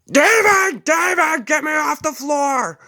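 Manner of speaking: high, loud shrieking from the bathroom; hammed-up old man yelling high and loud. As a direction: scream for help from the floor. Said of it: "The old man was yelling from the bathroom".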